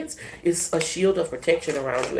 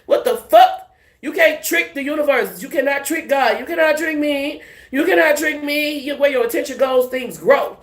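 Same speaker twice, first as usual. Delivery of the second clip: higher, louder defiant declaration; defiant rant; 8 semitones higher, 4 dB louder than usual. Real, not acted. Warning haters they can't trick her, higher and louder. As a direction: tell them off with fire.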